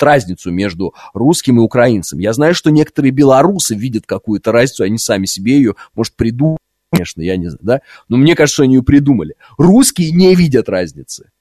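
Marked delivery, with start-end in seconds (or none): none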